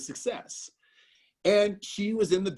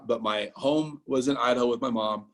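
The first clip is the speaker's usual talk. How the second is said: normal talking voice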